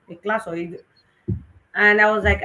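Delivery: ordinary talk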